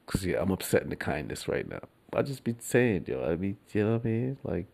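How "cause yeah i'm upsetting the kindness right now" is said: whispered aside. Real, not acted. Mischievous aside.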